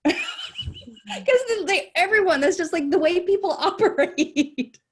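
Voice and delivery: giggling while explaining, slowing at the end; amused laugh-talk about people talking over each other. Real, not acted. Laughing about Mississippi newscasters.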